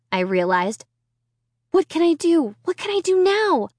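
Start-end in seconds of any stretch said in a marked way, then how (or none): 1.7-3.7 panicked. Acted. Amy panics.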